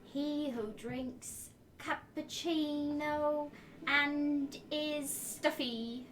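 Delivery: very slow, drawn-out title; slow pronouncement. Acted. Character naming someone with a title, slow and deliberate. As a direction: bestow it word by drawn-out word.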